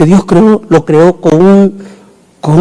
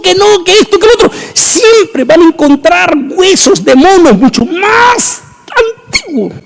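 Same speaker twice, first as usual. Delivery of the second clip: preaching at full force; 12 semitones higher than usual. Acted. Preacher crying out to his congregation in full force.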